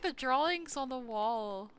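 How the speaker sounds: ordinary talk, nothing marked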